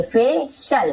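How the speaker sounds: फे, a short gap, then शल with "sh"; corrected in syllables. Shown as a demonstration. Teacher correcting the misreading, फे and शल set apart. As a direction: say "fay ... shal" in two separate parts. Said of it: "नहीं पढ़ेंगे".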